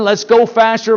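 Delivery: ordinary talk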